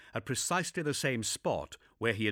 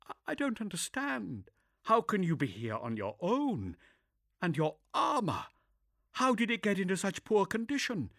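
higher brisk female voice; composed but shaken inquisitor; young woman voice; 11 semitones higher than usual. Acted. Zula confused.

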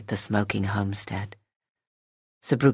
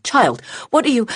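alarmed cry; 12 semitones higher, 6 dB louder than usual. Acted. Woman startled by armed child.

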